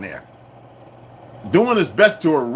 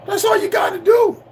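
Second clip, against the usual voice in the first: very high-pitched yell; exasperated shout; 3 dB louder than usual. Real, not acted. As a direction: cry it out in exasperation at the listeners. Exasperated demand that viewers research.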